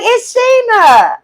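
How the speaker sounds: slow, high, put-on innocent tone; drawn-out mock sweet voice. Caller revealing herself, drawn-out mock sweetness. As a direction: mimic her sliding in sweetly. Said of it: "She was like".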